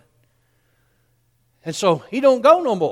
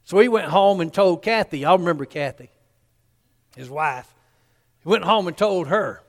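ordinary talk, nothing marked

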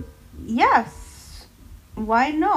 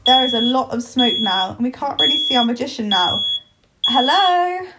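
normal talking voice

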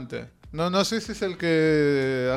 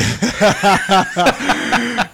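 laughs out loud, caught off guard; big real laugh; loud burst of laughter; 5 semitones higher, 9 dB louder than usual. Real, not acted. Laughing at a joke on air.

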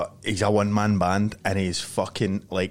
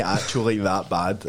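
usual speaking voice

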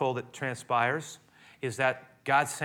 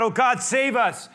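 desperate cry out; 10 semitones higher, 8 dB louder than usual. Retold or imitated. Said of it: "they begin to cry out".

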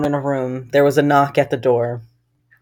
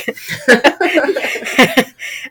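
laughter; 8 semitones higher than usual. Real, not acted. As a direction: giggle at the absurd story. Laughs at own story.

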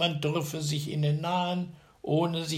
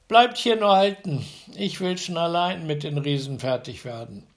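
loud, higher order to the riders; commanding tailor; 4 semitones higher, 7 dB louder than usual. A narrator's reading. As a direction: stop them at the edge of the wood. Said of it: "sprach er zu seinen Begleitern".

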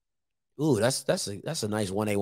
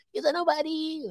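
high and softer imitation; Timona's high-pitched voice; 9 semitones higher, 9 dB quieter than usual. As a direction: toss off a high little mimic. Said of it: "what she would say".

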